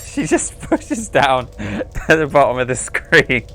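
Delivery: laughing through the words; can barely describe it for laughing; real amused laughter. Real, not acted. Cracks up describing the scene.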